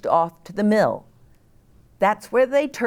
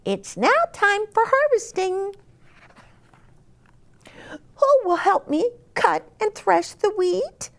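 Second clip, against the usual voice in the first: very high, clucky hen voice. Acted. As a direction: squeak it out like a busy little hen.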